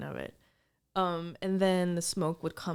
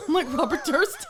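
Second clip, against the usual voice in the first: giggling joke, higher; laughing; 8 semitones higher, 5 dB louder than usual. Real, not acted. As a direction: laugh as you say it. Laughing at her burp.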